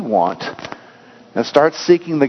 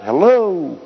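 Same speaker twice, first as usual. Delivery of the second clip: a boy's mild mock shout to the mountains; calling out; 4 semitones higher than usual. A narrator's reading. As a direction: call it out across the canyon, lightly. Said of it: "cup his hands around his mouth and shout".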